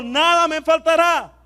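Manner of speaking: declared high and loud. Shown as a demonstration. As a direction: shout it out to the church. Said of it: "Vamos a decirlo de nuevo".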